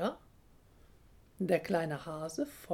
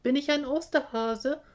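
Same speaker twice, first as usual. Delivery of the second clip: high, loud questioning call; 9 semitones higher, 8 dB louder than usual. A narrator's reading. Little hare to the flying geese, calling out. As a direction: call it up to the sky. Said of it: "ruft er ihnen zu".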